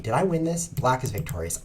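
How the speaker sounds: normal talking voice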